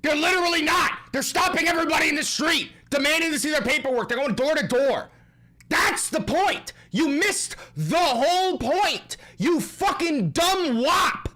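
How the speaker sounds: loud, high, fast angry rant. Real, not acted. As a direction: yell it in disbelief. Ranting at Cuomo.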